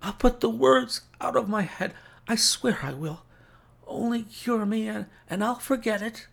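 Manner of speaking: high, strained invalid voice; pleading sick man. A narrator's reading. Sick Holmes begging for a cure, high strained plea. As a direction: promise desperately, pitched well up.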